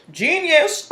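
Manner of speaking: smug. Acted. Self-congratulating in a put-on voice.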